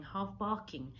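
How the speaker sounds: usual speaking voice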